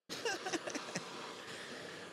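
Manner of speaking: soft airy high laugh; breathy laugh. Real, not acted. Streamer laughing to chat about the replay. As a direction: let out a quiet breathy laugh.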